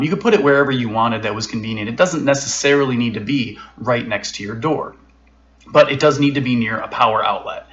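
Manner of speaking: usual speaking voice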